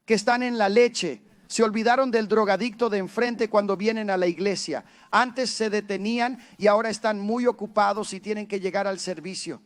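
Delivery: loud, fervent preaching. Acted. Pastor preaching to the church, loud and fervent. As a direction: drive the message home to the congregation.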